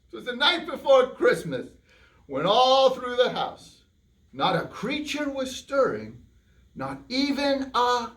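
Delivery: put-on slow, drawn-out reading to children; storybook reading. A narrator's reading. Host reading the poem aloud to kids, slow storyteller. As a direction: read it slowly for the kids.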